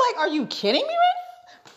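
very high-pitched disbelief; incredulous. Real, not acted. Storyteller on the ultimate disrespect, incredulous. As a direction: climb high in sheer disbelief.

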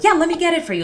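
high, loud, eager offer; over-eager helper. Retold or imitated. People-pleaser jumps up for roommates, high and over-eager.

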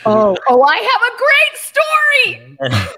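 very high, loud squeal; loud, high-pitched eager cry; excited outburst. Real, not acted. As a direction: burst in, bursting to tell it. Bursts in excitedly with a story.

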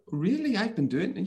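imitated child's high-pitched disbelief; surprised child. Child hearing mum's praise, high-pitched mock surprise. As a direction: squeak up like a kid pleasantly taken aback.

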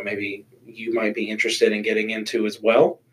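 usual speaking voice

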